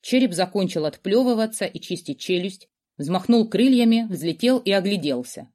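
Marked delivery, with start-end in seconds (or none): none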